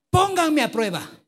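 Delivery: Pónganme a prueba called out high and loud; God's dare, proclaimed loudly; bold challenge. Retold or imitated.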